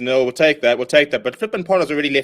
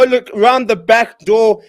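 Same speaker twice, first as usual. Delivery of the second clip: ordinary delivery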